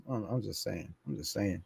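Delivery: quiet mumbled excuse; sheepish backpedal. Real, not acted. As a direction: murmur it as a cheeky excuse. Backpedalling after crude joke.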